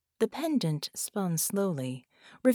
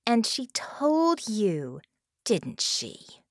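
growled; 4 semitones higher, much slower than usual. A narrator's reading. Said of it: "Harmony growled".

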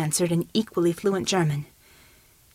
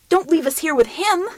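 frightened plea; 9 semitones higher, 4 dB louder than usual. Acted. Frightened plea to departing hero.

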